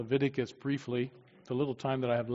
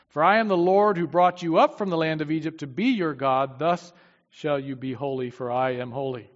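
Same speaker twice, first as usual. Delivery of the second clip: louder, solemn Bible reading; preacher reading God's words to the congregation, louder and solemn; scripture reading; 7 dB louder than usual. Acted. Preacher reads Leviticus to his congregation, solemn and louder.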